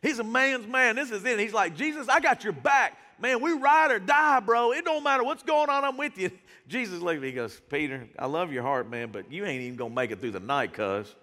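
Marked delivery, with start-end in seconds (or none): 1.7-6.3 louder, higher ride-or-die boast; bravado. Retold or imitated. Peter swearing loyalty to Jesus, loud cocky bravado. 7.7-11.1 lower, calm knowing reply; gentle Jesus. Retold or imitated. Jesus answering boastful Peter, low and gently knowing.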